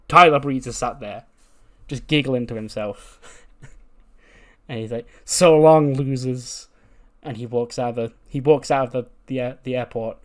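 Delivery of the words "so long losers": loud, drawn-out villain taunt; gloating farewell. Retold or imitated. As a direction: crow it at the people you tricked.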